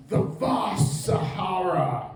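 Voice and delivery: slow, lingering "vast" in a British documentary voice; David Attenborough saying vast. Retold or imitated. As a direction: savour the word "vast" like a nature narrator. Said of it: "He'll say something like".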